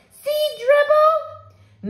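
put-on high, squeaky little kid; toddler voice. Toddler showing his turtle to a guest, high and eager. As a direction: pipe it up like a proud toddler.

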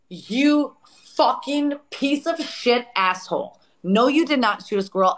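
retold angry outburst. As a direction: replay chewing him out in outraged disbelief. Re-enacted furious confrontation with Matt.

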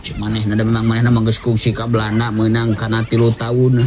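plain reading voice

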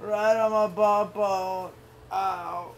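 slow, sore groaning words; real pained groan. Real, not acted. Dad telling toddler where it hurts, slow pained groan. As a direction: nurse a sore tailbone. Hurt after the fall.

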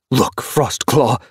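sharp she-cat. A narrator's reading. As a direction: cut her off sharply. Ginger she-cat snaps back.

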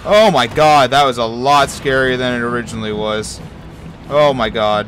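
loud startled outburst after a scare. Real, not acted. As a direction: shout it, genuinely spooked. Jump scare reaction.

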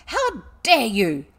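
slow, very loud fury; infuriated. A narrator's reading. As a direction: draw out each word in outrage. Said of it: "Miss Minchin was infuriated".

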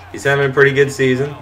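usual speaking voice

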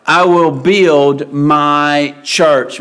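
slow solemn quote. Acted. Preacher quoting Jesus slowly.

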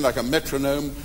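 ordinary talk

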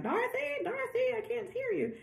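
high, faint voice breaking up like a bad line; thin high ghostly voice fading in and out; ghost through static. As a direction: call out as a faraway ghost. Ghost speaking through bad interference.

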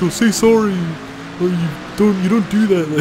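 high, loud mock-Canadian telling-off; imitated Canadian scolding. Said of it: "he was like".

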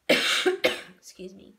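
coughing, then a hoarse excuse me. Real, not acted. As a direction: clear the throat, then apologize. Narrator coughs, apologizes.

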